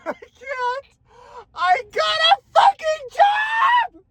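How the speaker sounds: high sobbing yell of joy; real tearful scream of joy; crying shout. Real, not acted. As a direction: sob and shout the news at once. Crying with joy over job.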